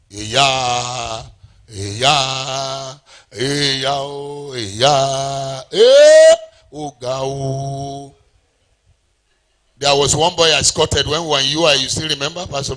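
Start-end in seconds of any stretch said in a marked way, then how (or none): 0.1-8.1 long drawn-out pitying "eyah"; mock pity. Preacher imitating people whose pity can't change your story, drawn-out mock pity. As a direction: stretch out exaggerated sympathy, slowly. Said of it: "people's pity can't change your story".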